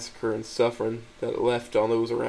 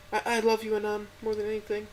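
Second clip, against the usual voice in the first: put-on a crying girlfriend pleading to help; high, gentle, tearful voice; tearful Stella; 10 semitones higher than usual. A narrator's reading. Stella's voice in the story.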